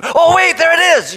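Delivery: high, delighted little gasp of rediscovery; kid spotting balloon again. Retold or imitated. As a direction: play the kid who spots it again. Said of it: "you're just like".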